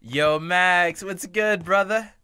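calling out loudly to the teacher. Acted. Student loudly greets the teacher to dodge trouble. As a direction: shout across the room, cheeky.

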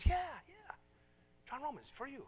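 high-pitched, eager confirmation; eager retold reassurance. Preacher telling a Russian woman it is free, acted eagerness. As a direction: excitedly imitate getting through to her. Retelling his eager reply to her.

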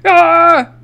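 high, loud yelp of alarm; sudden scream at being hit in the game; startled scream. Real, not acted.

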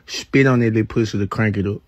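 ordinary delivery

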